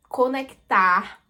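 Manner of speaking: ordinary delivery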